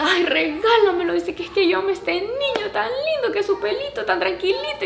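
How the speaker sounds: put-on high-pitched cooing over a little boy; mimicked doting farm girl; imitated adoring gushing. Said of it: "Súper linda con Lu".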